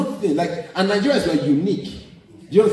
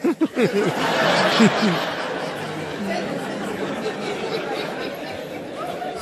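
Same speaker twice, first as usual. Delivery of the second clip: long breathy laugh; 6 semitones higher than usual. Real, not acted. Laughing after the greeting.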